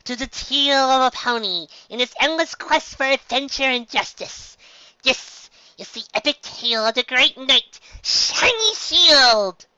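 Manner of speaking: high, loud, slow epic build; dramatic storyteller. A narrator's reading. Pinkie introducing her ballad, grand and high. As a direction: announce the epic like a bard. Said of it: "her face uncharacteristically solemn".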